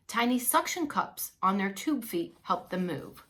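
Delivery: ordinary delivery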